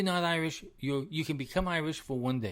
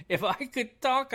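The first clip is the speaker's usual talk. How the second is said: laughing through the words, much higher; 11 semitones higher, 3 dB louder than usual. Real, not acted. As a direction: giggle it out at your own joke. Laughing at own joke about podcasting.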